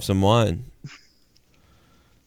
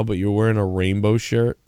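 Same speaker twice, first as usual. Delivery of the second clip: ordinary delivery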